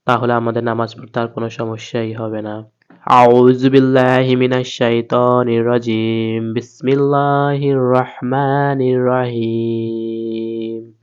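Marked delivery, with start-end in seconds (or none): 3.1-6.5 chanted tilawat, steady and full; Quranic recitation. Shown as a demonstration. Opening recitation before lesson. 6.5-10.9 slow, drawn-out melodic chant; Quranic recitation. Shown as a demonstration.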